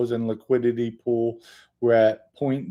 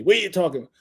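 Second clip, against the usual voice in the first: higher-pitched, mock-indignant comeback; retold retort; 6 semitones higher, much faster than usual. Mom answers son's scolding about the phone, mock-indignant. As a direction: imitate mom firing back, pitched up. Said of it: "she went back at me".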